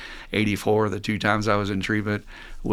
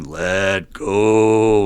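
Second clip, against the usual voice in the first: the God voice, louder; booming louder; 6 dB louder than usual. Acted. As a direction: boom it down from above. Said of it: "louder than ever".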